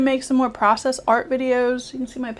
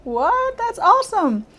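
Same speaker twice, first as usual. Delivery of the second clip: high, astonished "What?" then delighted; amazed disbelief; 10 semitones higher, 4 dB louder than usual. Retold or imitated. Past self hearing about the fourth book, amazed and thrilled. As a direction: gasp at the news, then cheer it. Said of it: "I'd have been like".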